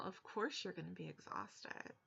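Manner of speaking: usual speaking voice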